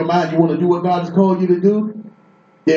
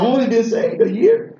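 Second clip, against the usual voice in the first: pitched-up protest; high disbelief; 5 semitones higher than usual. Shown as a demonstration. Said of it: "just like that".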